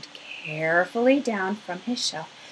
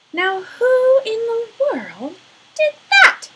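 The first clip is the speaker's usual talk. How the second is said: a night watchman alarmed by the crash; startled watchman; 13 semitones higher, 7 dB louder than usual. Acted. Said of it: "he exclaimed".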